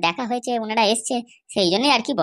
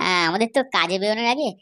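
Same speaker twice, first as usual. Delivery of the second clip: ordinary talk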